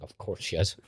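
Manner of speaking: hushed sarcastic aside breaking into a laugh. Retold or imitated. Friend recognising yet another reality star, laughing. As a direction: mutter it knowingly then crack up. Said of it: "I'm like".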